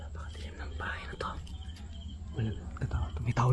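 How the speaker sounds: hushed whisper in the dark. Real, not acted. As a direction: breathe the words, no voice.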